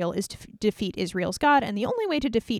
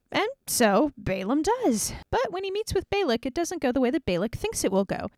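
usual speaking voice

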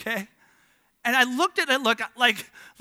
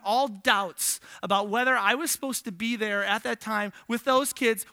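normal talking voice

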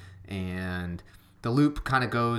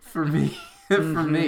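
laughing; 6 semitones higher, 6 dB louder than usual. Real, not acted. Laughing repeat.

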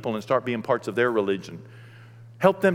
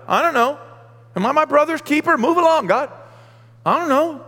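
high, loud defiant snapping; defiant Cain; 13 semitones higher, 8 dB louder than usual. Acted. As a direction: dismiss God with loud defiance. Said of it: "And he's like".

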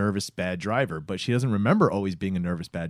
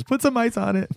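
high mock advice; 12 semitones higher than usual. Mocking her line.

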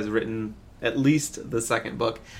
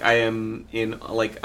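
ordinary delivery

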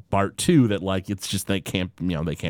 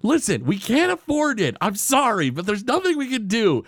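higher apologetic dad voice; a touch of sorry Homer; imitating Homer; 10 semitones higher than usual. Homer tells the dog they can't afford it, in a higher sorry voice. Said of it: "And Homer's like".

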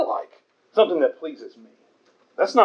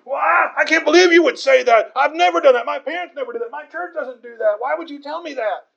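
indignant outburst; 10 semitones higher than usual. Retold or imitated. A churchgoer snapping back at the preacher, indignant. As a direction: bristle as if accused of wrong. Someone comes at you upset.

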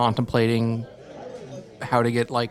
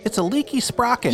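character voice; 11 semitones higher than usual. Acted. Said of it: "That is your character voice?".

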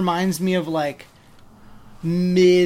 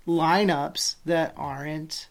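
normal talking voice

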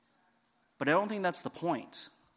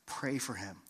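low, hushed, patient prompting; quiet inner voice of God; voice of the Lord; 5 semitones lower, 11 dB quieter than usual. Retold or imitated. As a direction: repeat the instruction very softly and low. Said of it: "this voice of the Lord saying".

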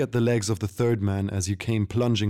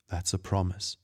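quiet threat; 6 dB quieter than usual. A narrator's reading.

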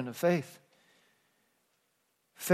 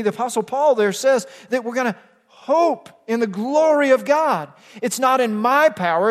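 louder, rising preacher's fervour; preaching at full force; 5 semitones higher, 6 dB louder than usual. Acted. Pastor urging the congregation toward hope, building fervently. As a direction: drive it home from the pulpit.

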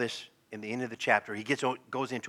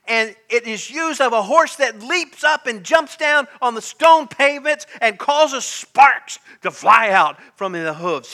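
raised, loud, driving sermon delivery; full-force preaching; 8 semitones higher, 11 dB louder than usual. Real, not acted.

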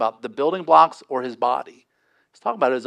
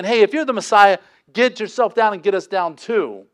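mock taunt; 5 semitones higher, 5 dB louder than usual. Thieves taunting Jesus on cross.

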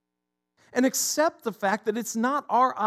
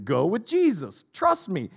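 usual speaking voice

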